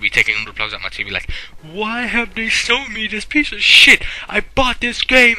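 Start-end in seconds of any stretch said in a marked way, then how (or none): 1.6-5.4 high, drawn-out American whine of outrage; mock-outraged American, pitched way up and slowed down; American accent. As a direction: put on a high, slow American complaint. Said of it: "I did an American accent".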